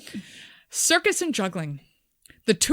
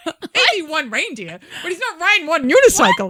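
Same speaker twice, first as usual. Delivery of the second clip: laughing rant; 8 semitones higher, 7 dB louder than usual. Real, not acted. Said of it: "laughing rant".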